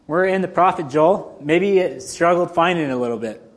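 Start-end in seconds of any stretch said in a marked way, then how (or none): none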